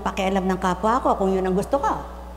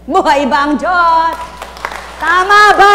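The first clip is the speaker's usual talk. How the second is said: preacher's rallying cry; 7 semitones higher, 10 dB louder than usual. Real, not acted. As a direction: belt it out to stir the faithful. Preacher's call.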